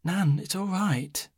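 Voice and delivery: gentle reassuring voice; comforting. A narrator's reading. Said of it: "Liz comforted her".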